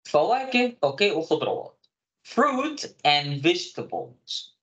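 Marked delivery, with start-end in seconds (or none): 2.3-4.5 very slow, drawn out; slow model phrase. Shown as a demonstration. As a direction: stretch it out for the class to repeat. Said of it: "هاخد من أول اليسار".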